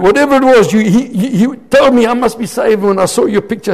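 high, loud, excited retelling; emphatic insistence. Retold or imitated. Convert brushing off the twin mix-up, emphatic insistence. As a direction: override the objection, loud and excited.

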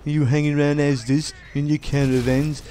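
put-on drawn-out jeering in an English accent; mock English abuse. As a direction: put on an English yob hurling insults. Mimicking English abuse of Irish people.

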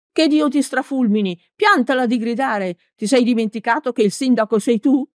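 high-pitched exasperated telling-off; scolding shout. A narrator's reading. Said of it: "gli gridò nell'orecchio il brusco".

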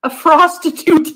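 loud, high drawn shout; mock announcement; gleeful shout. Real, not acted. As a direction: yell it for fun.

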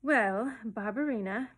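coaxing, wheedling address; gently angling for a favour. A narrator's reading. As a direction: wheedle a little child. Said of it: "in his soft, wheedling voice".

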